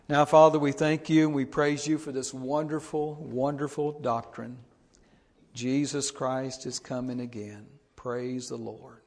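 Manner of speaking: loud fervent pulpit prayer. Real, not acted. Pastor prays aloud over his congregation, fervent. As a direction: lift it up to God with full force.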